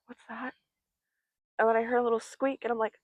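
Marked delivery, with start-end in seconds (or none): 0.1-0.5 much softer, wary question in the dark; hushed question. Retold or imitated. Woken by skittering in the dark, hushed. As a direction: ask it barely aloud. Hearing noise in dark room.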